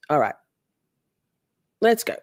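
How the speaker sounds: ordinary delivery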